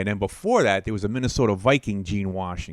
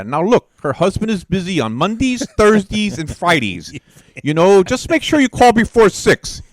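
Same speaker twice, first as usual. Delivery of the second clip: loud hammed-up quote; 9 semitones higher, 9 dB louder than usual. Quoting a show line, loud.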